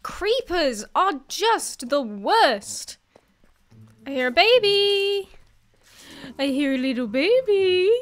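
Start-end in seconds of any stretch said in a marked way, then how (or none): 4.1-7.9 cooing. Pretend. Cooing at unseen baby mob.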